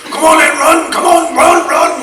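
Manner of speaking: put-on loud, high-pitched yell with a poor Welsh accent; Welsh PE master. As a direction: bellow like a PE master chasing runners. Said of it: "Welsh P E master used to come up behind you".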